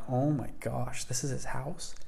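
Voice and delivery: breathed out softly, stunned by the house; a soft, amazed murmur to himself; hushed awe. Retold or imitated. Arriving at a huge mansion.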